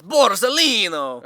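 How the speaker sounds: high, loud, drawn-out mock Italian accent; over-the-top Italian accent. Shown as a demonstration. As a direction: sing out the name like a hammy Italian. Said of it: "say it with that accent".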